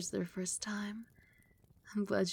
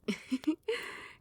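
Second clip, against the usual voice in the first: light airy amused giggle; 7 semitones higher than usual. Acted. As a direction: giggle at their sweet worry. Amused by the pet's concern.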